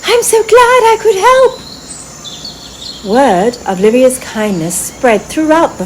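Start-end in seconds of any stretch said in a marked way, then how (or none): 0.1-1.5 high fairy voice, quick and smiling; warm fairy. A narrator's reading. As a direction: beam with gladness at the squirrel. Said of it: "Lyria smiled warmly".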